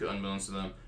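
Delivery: ordinary talk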